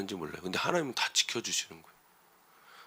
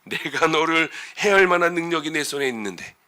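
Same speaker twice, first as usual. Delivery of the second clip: higher, louder mock threat; boastful Laban; 4 semitones higher, 6 dB louder than usual. Preacher replaying Laban's lying claim of power, threatening. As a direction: play up Laban's empty boast. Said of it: "앞에 바로 거짓말하고 지금 이야기하는".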